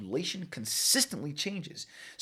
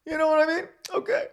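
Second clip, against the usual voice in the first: excited squeal; 6 dB louder, faster than usual. Real, not acted. Hyped vlogger kicking off the story.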